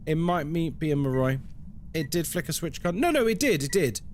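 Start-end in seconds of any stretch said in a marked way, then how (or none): none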